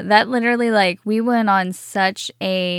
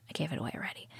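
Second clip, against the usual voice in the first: whispered aside; 6 semitones lower, 19 dB quieter, much faster than usual. Real, not acted. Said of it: "excuse me".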